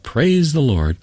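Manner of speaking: high, falling call of praise; retold crying out. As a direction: imitate a woman calling out praise. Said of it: "cry out".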